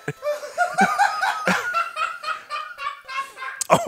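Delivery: long high wheezing laugh; hysterical laugh. Real, not acted. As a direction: lose it completely laughing. Watching friend's repeated failures.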